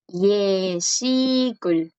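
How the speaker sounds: Yeshikul stretched out very slowly for the student; teacher modelling Yeshikul slowly to repeat; slow model word. Shown as a demonstration. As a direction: draw out Yeshikul syllable by syllable, slow. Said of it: "¿Te gustaría decirlo una vez?".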